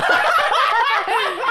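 high embarrassed giggle; awkward laugh. Shown as a demonstration. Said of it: "你看，像这样". (尴尬的笑)

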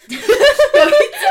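high, loud, slow laugh into the words; laughing hard, drawn-out. Real, not acted. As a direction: crack up while starting the story. Bursting out laughing mid-story.

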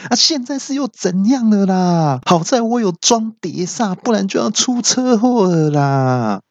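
annoyed at being stopped again; a high-pitched, hammed-up fuming complaint; exasperated yell. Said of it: "气到大喊".

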